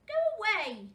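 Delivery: high, loud "Go away"; bossy shout. Acted. Girl guarding her iceberg from a penguin, bossy high shout. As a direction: shoo the penguin off sharply. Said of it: "said Tofter".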